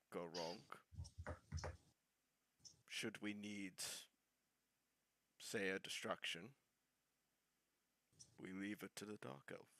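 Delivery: low, very soft conspiratorial whisper; hushed scheming aside to an ally; whispered in character. Acted. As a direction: murmur it secretly into his ear. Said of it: "I whisper into his ear".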